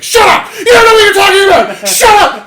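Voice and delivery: screaming, high and loud, rushed; hammed-up royal tantrum; furious king. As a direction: shriek it like an enraged king. King yells at Griffith.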